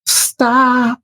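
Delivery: ordinary talk, nothing marked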